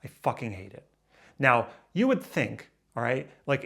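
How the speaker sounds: ordinary delivery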